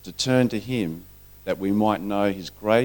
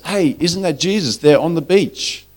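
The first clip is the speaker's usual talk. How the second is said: higher, surprised call to friends; excited calling out; 6 semitones higher, 4 dB louder than usual. Acted. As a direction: point it out with rising excitement. John spots Jesus from boat.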